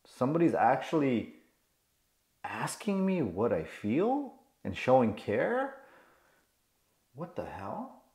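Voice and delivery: soft, hesitant disbelief; acted stunned child, hushed and questioning; shocked little boy. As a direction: wonder quietly that anyone cares. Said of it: "the little boy is shocked".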